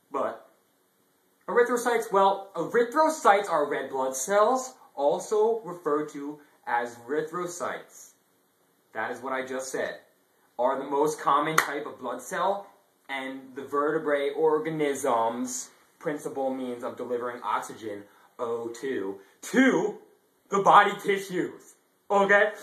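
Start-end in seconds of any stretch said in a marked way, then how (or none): 1.5-8.1 yelling. Acted. Yelling continues, then fades. 19.4-22.5 yelling. Acted. Brief loud outburst.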